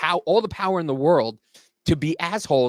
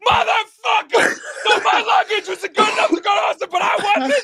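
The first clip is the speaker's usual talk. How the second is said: squeaky furious rant through laughter; outraged traveler. Retold or imitated. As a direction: blow up in disbelief, cracking up. Angry man hearing luggage news.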